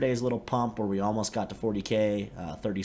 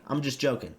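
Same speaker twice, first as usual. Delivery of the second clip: ordinary talk, nothing marked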